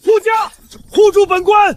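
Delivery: high, loud cry for protection; panicked command. Acted. Official calling guards to shield him, panicked shout.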